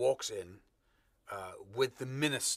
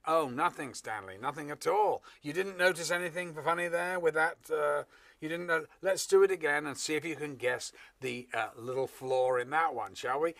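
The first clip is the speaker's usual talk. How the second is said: feigned innocence, raised pitch and volume; mock innocence; 5 semitones higher, 5 dB louder than usual. Actor answers Stanley about the funny take, mock innocent. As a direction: play coy about an obvious flaw. Actor's acted reply to director.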